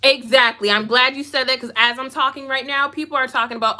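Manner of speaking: ordinary delivery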